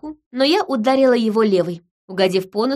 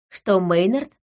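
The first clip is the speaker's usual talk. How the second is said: plain reading voice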